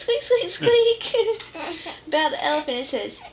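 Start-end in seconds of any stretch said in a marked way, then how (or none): 0.0-1.4 mouse squeak. Pretend. Repeating mouse sound for toddler.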